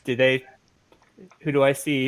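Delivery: usual speaking voice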